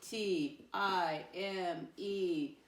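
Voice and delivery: very slow, letter by letter; spelling out. Shown as a demonstration. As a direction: spell it out with space between letters. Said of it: "Tell me how to spell that word".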